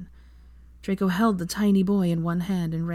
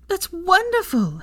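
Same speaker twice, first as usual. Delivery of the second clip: higher, slow, louder exclaim; 14 semitones higher, 4 dB louder, slower than usual. A narrator's reading. Hermione praising Draco's spell, exclaiming higher. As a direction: exclaim it with joy. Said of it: "she exclaimed".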